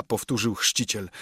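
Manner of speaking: plain reading voice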